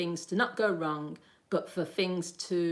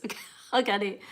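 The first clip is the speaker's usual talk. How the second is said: amused laugh; 3 semitones higher, 4 dB louder, faster than usual. Real, not acted. Laughing at a viewer's joke.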